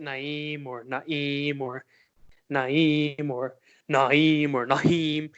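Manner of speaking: "Naeem" several ways, stretched, ending in "Naheem"; varied mispronunciations. Shown as a demonstration. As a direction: run through other people's versions of "Naeem". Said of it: "they pronounce it".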